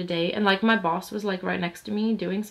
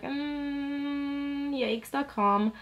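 awkward yikes; 5 semitones higher, 3 dB quieter, much slower than usual. Retold or imitated. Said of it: "I was like".

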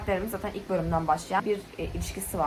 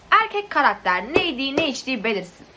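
higher, louder, worked-up complaining; exasperated rant; 6 semitones higher, 5 dB louder than usual. Real, not acted. Reviewer listing a male character's faults to viewers, exasperated. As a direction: rant as if barely keeping your cool. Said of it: "Sakin olmaya çalışıyorum".

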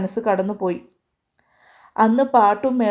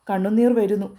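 ordinary delivery

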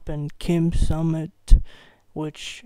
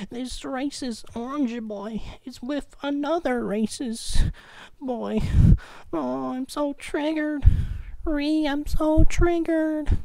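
high, whiny mock outrage, climbing higher; mocking triggered liberal; 9 semitones higher, 10 dB louder than usual. Mocking liberals about the Trump and Kim summit, high whiny mock outrage. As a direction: parody a shrieking triggered protester. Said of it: "a lot of liberals are like".

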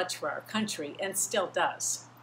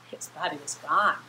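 normal talking voice

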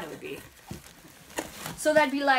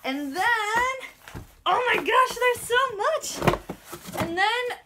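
high, gushing squeal of delight; real thrill bubbling over, pitch way up; breathless unboxing squeal; giddy excitement; 10 semitones higher, 4 dB louder, slower than usual. Real, not acted.

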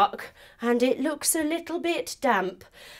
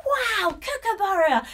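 excited; 9 semitones higher, 4 dB louder than usual. Real, not acted. Excited praise after song.

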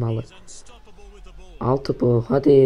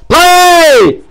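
high, loud shout of the name; 14 semitones higher, 12 dB louder than usual. Real, not acted. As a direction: yell the name out. Cheering player's name.

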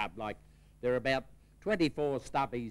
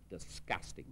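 muttered disgust; 7 semitones lower, 11 dB quieter than usual. Acted. Husband back from the pub.

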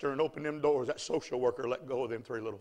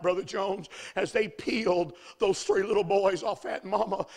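ordinary talk, nothing marked

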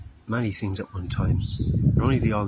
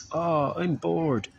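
put-on bored child whine; 7 semitones higher, much slower than usual. Child whining about boredom.

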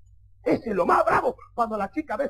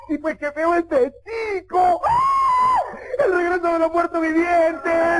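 high, slow hammed-up wail; loud mock-horror screams, drawn out; horrified aunt; 6 semitones higher, 4 dB louder, much slower than usual. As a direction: shriek as an appalled aunt. Aunt shrieks at ugly suitor.

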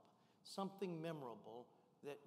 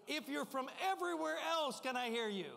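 much higher, loud rally call; crowd call; 8 semitones higher, 5 dB louder than usual. Shown as a demonstration. Speaker calling everyone else, high loud call. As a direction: lift the room with a big call. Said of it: "could I hear you give a big whoopee".

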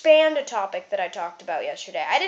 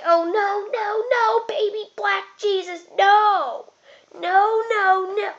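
high-pitched comic wail of dread; mock panic; 7 semitones higher, 4 dB louder, slower than usual. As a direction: plead in hammed-up panic. Acted panic at the clippers.

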